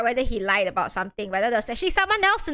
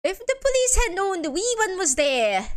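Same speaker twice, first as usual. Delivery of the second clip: higher, lilting fake Irish; poor Irish accent; 5 semitones higher than usual. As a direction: put on a rough Irish accent. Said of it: "doesn't sound Irish at all".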